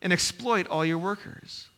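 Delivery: scripture reading. Acted. Reads scripture aloud.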